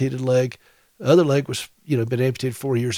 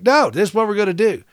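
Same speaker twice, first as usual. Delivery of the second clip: higher and fast, determined; wife's insistence; 7 semitones higher, 3 dB louder, faster than usual. Retold or imitated. Wife overruling her husband, higher, quick and firm. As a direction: press the plan, higher and quicker.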